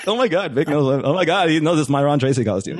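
mildly hammed-up starstruck gushing; starstruck excitement. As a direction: replay being shaky with excitement. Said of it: "I'm, like, all shaking and stuff".

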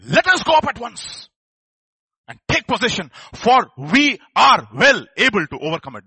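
slow, loud rallying shout. Acted. Caleb quieting the doubting people, slow rallying shout. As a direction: shout it slowly as a bold rallying call. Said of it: "Park louder".

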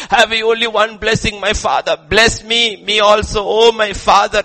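loud, high declaimed begging. Acted. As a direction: lift up your voice and implore. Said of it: "Esau lifted up his voice".

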